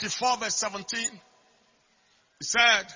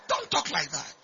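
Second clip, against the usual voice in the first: normal talking voice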